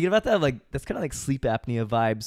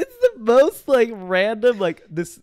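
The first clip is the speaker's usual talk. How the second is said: high, loud laughter through the words; barely getting words out while laughing; 7 semitones higher, 6 dB louder than usual. Real, not acted. Laughing while retelling awkward moment.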